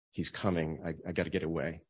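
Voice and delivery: low, hushed mock urgency; furtive aside. Voicing people avoiding God, hushed and furtive. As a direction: drop the voice as if slipping away.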